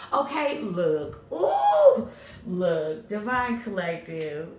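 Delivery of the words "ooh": high, loud delighted ooh; excited squeal. Real, not acted. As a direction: squeal with delight at the good news. Delighted reaction to the message.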